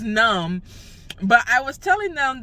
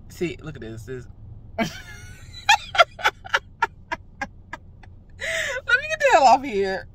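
laughing; 12 semitones higher than usual. Real, not acted.

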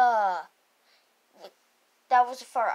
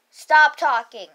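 ordinary talk

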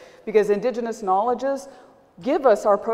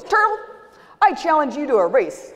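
calling out a challenge; 7 semitones higher than usual. Acted. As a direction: sing out the challenge, cocky. Rabbit challenges Turtle.

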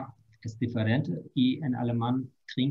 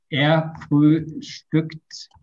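Er frühstückt very slow and louder, Er leaning; slow practice demo; 3 semitones higher, 4 dB louder, much slower than usual. Shown as a demonstration. Teacher drilling a student on the verb, slow and emphatic. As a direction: model it slowly for the student to copy. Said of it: "Ya vamos a entrenarlo".

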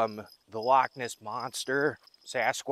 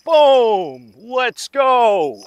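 excited shout; 11 semitones higher, 15 dB louder, much slower than usual. Real, not acted. Landing a big catfish.

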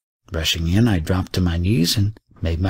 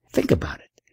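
whispering; 5 semitones lower than usual. A narrator's reading. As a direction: breathe it like a secret. Old lama whispers advice.

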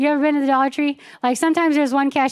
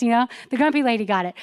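ordinary delivery

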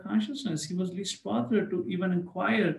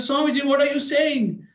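much higher, louder alarmed cry; shocked; 10 semitones higher, 7 dB louder than usual. Retold or imitated. Man hears disaster in his palm, shocked cry. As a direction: gasp out the question in shock.

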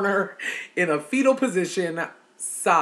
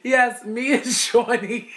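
higher and slower, laughter in the voice; laughing; 7 semitones higher, 5 dB louder, slower than usual. Real, not acted. Telling viewers about her son and chitlins, laughing. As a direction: talk through leftover giggles.

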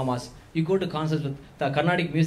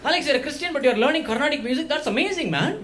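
impressed fan voice; 7 semitones higher than usual. Retold or imitated. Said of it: "People go like".

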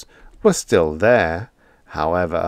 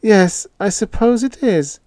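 much higher, louder nervous concession; uneasy landlady; 13 semitones higher, 5 dB louder than usual. A narrator's reading. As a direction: concede it with a shiver inside. Cold feeling inside.

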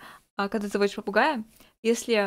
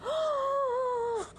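high gasp; 13 semitones higher, 5 dB quieter than usual. Real, not acted. Shocked gasp at a clip.

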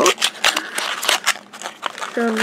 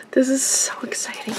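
fast excited whisper; 9 dB quieter, faster than usual. Real, not acted. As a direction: breathe it out in a rush. Hushed thrill over new toy.

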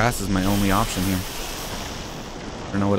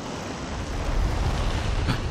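sharp frightened intake of breath; scared gasp; 6 dB quieter than usual. Real, not acted. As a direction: gasp in fear. Scared gasp hiding from monster.

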